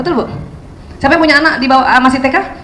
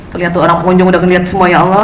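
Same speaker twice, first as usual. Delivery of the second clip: flustered mutter; 9 semitones lower, faster than usual. Retold or imitated. Embarrassed mother in mall.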